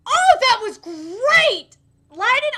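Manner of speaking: usual speaking voice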